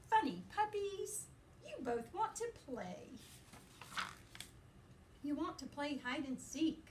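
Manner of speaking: lively, sing-song picture-book reading; reading to children. A narrator's reading. Teacher reading a puppy book to her class, playful and lively. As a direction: read it brightly to little listeners.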